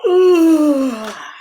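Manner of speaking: loud high put-on yawn; big yawn. Reading the yawn book to a child, a huge yawn. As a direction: stretch out a huge theatrical yawn. Yawn after counting noses.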